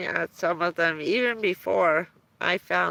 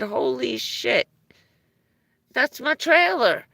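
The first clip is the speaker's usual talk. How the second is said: mock shocked realization; 5 semitones higher, 4 dB louder than usual. Father realizing it's his trailer.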